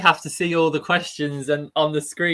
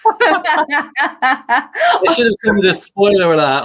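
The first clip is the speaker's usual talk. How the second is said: laughing; 7 semitones higher, 3 dB louder, much slower than usual. Real, not acted. Joking about Sirius spoiler.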